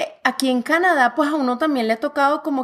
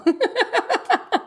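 risa aguda; 9 semitones higher than usual. Real, not acted. Se ríe de su propia anécdota.

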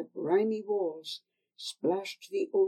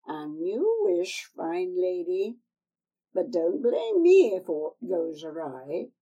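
put-on old crone; 6 dB louder than usual. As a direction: coax her like a sly old crone. Old woman speaks again.

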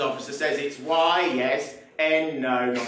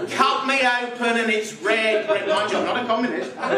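mock the die-hard Labour man, chuckling; a laughing send-up of a lifelong Labour voter; Labour loyalist; 7 semitones higher, 4 dB louder than usual.

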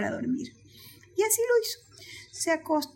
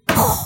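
sudden loud burst of mouth noise; imitated crash; 8 dB louder than usual. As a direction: make a sudden crash sound. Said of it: "un estruendo como este". (estruendo imitado, seco y fuerte)

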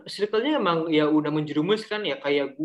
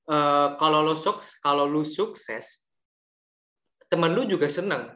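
slow recitation; 2 semitones lower than usual. Retold or imitated. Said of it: "ada quotes-nya kan".

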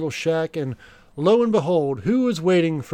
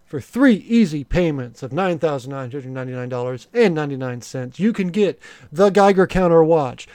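home shopping host; 2 semitones higher, 3 dB louder than usual. Pretend. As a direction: sell it like a late-night infomercial. Mock infomercial Gadget Time pitch.

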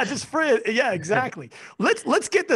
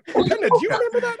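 much higher, quick teasing question; high playful tease; 4 dB louder, faster than usual. Acted. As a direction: toss it up high and fast.

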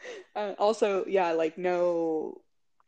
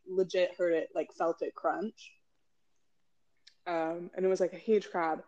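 usual speaking voice

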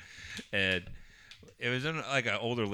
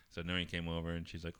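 normal talking voice

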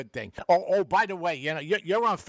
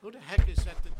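ordinary talk, nothing marked

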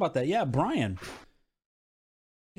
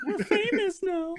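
high, slow hammed-up gushing; mock excited; slower than usual. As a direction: ham up being famous. Joking famous claim.

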